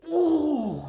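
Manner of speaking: loud, high whoop; drawn-out high "oooh"; mock-awed "ooh". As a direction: whoop as if thrilled by chills. Mock awe at replayed clip.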